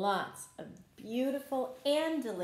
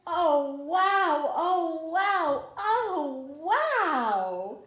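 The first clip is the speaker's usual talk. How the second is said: higher, louder string of delighted wows; amazed oh wow; 6 semitones higher, 8 dB louder, much slower than usual. Acted. Teacher marvels at the grown garden for children, delighted wows. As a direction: gasp with wonder at the garden.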